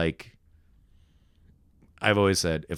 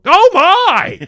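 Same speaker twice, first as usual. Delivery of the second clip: very high, very loud hammed-up shock; overacted gasp; 17 dB louder than usual. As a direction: blast the line like a bad extra. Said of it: "I just go".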